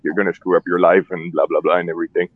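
normal talking voice

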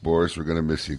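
ordinary talk, nothing marked

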